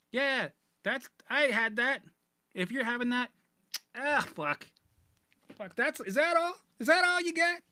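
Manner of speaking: high mocking voice, hammed-up; taunting voice. The anxious brain mocking its victim in a high voice. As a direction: taunt like a cocky demon.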